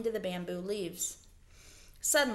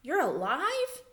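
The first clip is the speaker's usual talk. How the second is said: startled; 6 semitones higher than usual. Acted.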